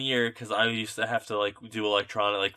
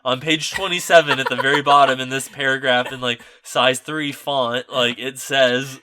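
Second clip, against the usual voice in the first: mock pedantry; 3 semitones higher, 9 dB louder than usual. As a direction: spell out absurd fine print as mock officialdom. Mocking staff citing fine print.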